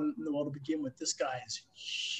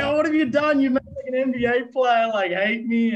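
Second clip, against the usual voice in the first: much higher, louder, mock-wounded whine; whiny Dan McGrath; 7 semitones higher, 5 dB louder than usual. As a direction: play the teammate crying foul, high and whiny. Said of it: "Dan's like".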